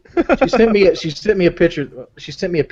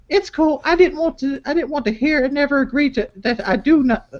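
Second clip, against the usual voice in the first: put-on high falsetto girlfriend voice reading texts; girly voice; 12 semitones higher than usual. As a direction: read her lines in a squeaky girly voice. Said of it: "I got fucking up with the voice".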